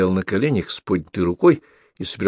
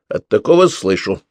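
cheeky retort; 2 semitones higher, 5 dB louder than usual. A narrator's reading. Pavlik retorts.

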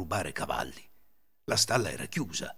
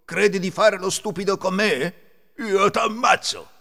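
high, loud angry threat; threaten, stretching the final words; furious threat; 9 semitones higher, 7 dB louder, slower than usual. A narrator's reading. Thief about to kill his companion, acted fury. Ladro infuriato minaccia.

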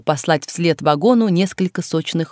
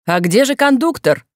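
higher-pitched policeman's shout; called-out question; 5 semitones higher than usual. A narrator's reading. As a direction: call out, demanding the missing man. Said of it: "крикнул один из полицейских". (окрик полицейского, повыше тоном)

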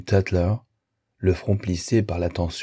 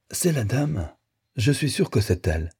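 fluting; 6 semitones higher than usual. A narrator's reading. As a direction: pipe it up like a flute. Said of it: "flûta Zinni".

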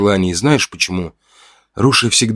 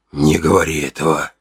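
lower, husky, deflated; voice sinking; 3 semitones lower than usual. A narrator's reading. Hunter begging Una to stop, voice giving way. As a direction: let the voice drop out of him. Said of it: "Его голос сел".

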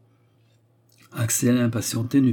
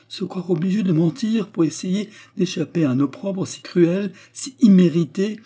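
high, louder outpouring of indignation; impassioned plea; 8 semitones higher, 4 dB louder than usual. A narrator's reading. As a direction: plead her cause with fervour. (plaidoyer indigné et fervent)

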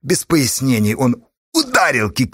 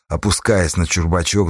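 usual speaking voice

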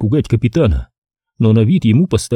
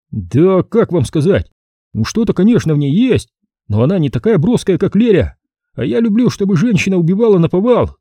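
lustful captain, pitched up; enthusing Savelyev; 7 semitones higher than usual. A narrator's reading. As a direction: gush about her. Captain cautiously praises women.

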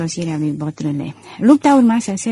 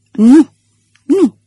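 startled denial; 6 semitones higher, 9 dB louder than usual. Acted.

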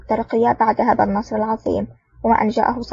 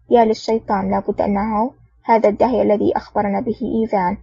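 ordinary delivery

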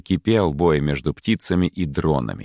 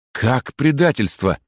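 higher, drawn-out alarmed question; agitated exclamation; 6 semitones higher than usual. A narrator's reading. Sparrow hears of possible treachery, agitated. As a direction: exclaim in troubled disbelief. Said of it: "взволнованно воскликнул Воробей".

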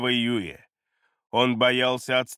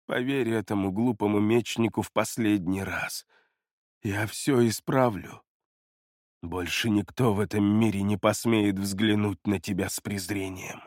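low, hushed and gentle; tender reassurance; 6 semitones lower, 3 dB quieter than usual. A narrator's reading. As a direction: soothe him softly, promise everything.

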